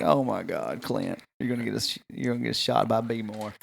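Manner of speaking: dropped-voice fretting about a risky friend; worried aside. Retold or imitated.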